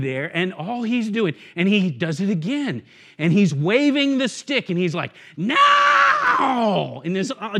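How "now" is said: piercing, drawn-out high shout; crazed old man's yell, hammed up; ringing yell. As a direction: let it ring out, wild and very high. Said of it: "this rings in my head".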